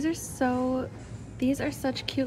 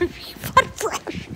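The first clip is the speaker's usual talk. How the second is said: laughing; 8 semitones higher, slower than usual. Real, not acted. Laughing repeat of catchphrase.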